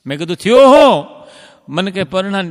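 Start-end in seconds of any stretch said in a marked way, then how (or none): 0.4-1.1 higher and louder quick jab; mock surprised retort. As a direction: snap the question back, teasing. Narrator's sharp retort. (ઊંચા અવાજે ચીડવતો સવાલ)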